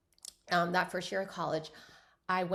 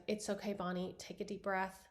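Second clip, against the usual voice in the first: softer, gentle calming voice; soft reassurance; 2 semitones lower, 8 dB quieter than usual. Retold or imitated. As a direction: soothe a crying friend. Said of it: "And she's just like".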